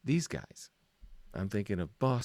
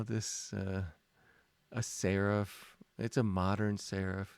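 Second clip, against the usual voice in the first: usual speaking voice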